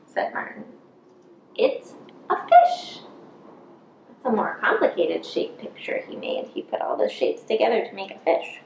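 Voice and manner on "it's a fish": higher, slower proud announcement; delighted little mouse; proud reveal. Acted. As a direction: show off the fish picture. Martin reveals his picture.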